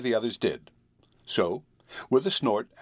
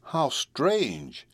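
mild exclamation, pitched up; exclaimed; 5 semitones higher than usual. A narrator's reading. Girl amazed at a man walking on air, exclaiming. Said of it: "she exclaimed".

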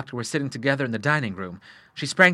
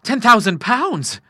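high, loud, drawn-out amazement; astonished cry; 11 semitones higher, 7 dB louder, slower than usual. A narrator's reading. Son staring at the two cheques, astonished cry. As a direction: cry out slowly in sheer bewilderment. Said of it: "he cried".

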